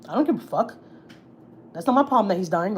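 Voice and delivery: attitude. Retold or imitated. Mom dismisses news of a dying man, with attitude. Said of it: "stop giving me attitude".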